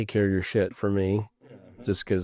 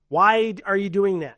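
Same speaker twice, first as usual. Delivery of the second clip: high, loud exasperated question; mock exasperation; 12 semitones higher, 6 dB louder than usual. Parents questioning the generation they named, mock exasperated. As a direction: demand an answer in mock disbelief.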